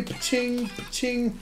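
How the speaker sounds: high, ringing pching noises; imitated metallic pching. Player voicing the metal spikes, imitated metallic pching. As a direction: repeat a high metallic sound effect evenly.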